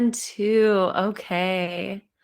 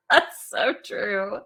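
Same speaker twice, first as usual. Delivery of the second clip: ordinary delivery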